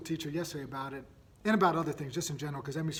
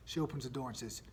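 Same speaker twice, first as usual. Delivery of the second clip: usual speaking voice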